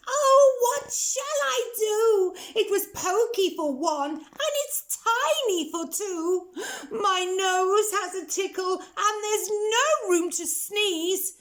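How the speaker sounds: put-on high, wailing old lady voice; fretful old lady lament; crying old lady. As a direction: wail about the cramped house, high. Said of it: "the little old lady cried".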